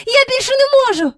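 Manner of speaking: high, loud, fast outburst; desperate cry. A narrator's reading. Child unable to wait any longer, desperate cry. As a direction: burst out as if at breaking point. Child overwhelmed while winding string.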